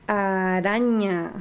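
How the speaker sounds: "Araña" drawn out slowly from the first A; slow, sustained "Araña" for a learner; initial-sound demo. Shown as a demonstration. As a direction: stretch the opening A of "Araña". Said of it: "comienzan con la letra que hace A".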